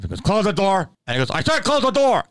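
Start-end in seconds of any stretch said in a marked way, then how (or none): 0.2-0.9 higher, imitated repeat command; mock the man insisting; insistent order. Hotel man repeating his order to him, more insistently. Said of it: "Like that". 1.3-2.2 high-pitched mock yell, stress on SAID; exasperated order. Hotel man ordering him a third time, exasperated. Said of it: "Like that".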